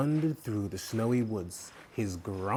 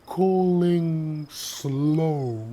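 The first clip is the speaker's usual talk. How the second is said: very slow, high and loud, each word stretched; slow, high, dragged-out words; long drawn-out drawl; 9 semitones higher, 6 dB louder, much slower than usual. Acted. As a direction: stretch every word out like a turtle. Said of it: "in a long, drawn-out drawl".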